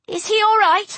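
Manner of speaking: anxious cry to the nurse; worried cry. A narrator's reading. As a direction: call out in sudden worry. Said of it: "I cried".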